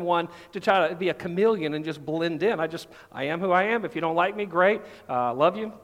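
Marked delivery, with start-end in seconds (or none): none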